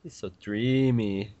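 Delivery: soft, slow mock swoon; soft teasing sigh; mock dreamy.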